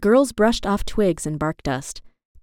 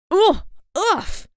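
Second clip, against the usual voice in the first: effort grunts; 11 semitones higher than usual. A narrator's reading. Jade tumbling off skateboard.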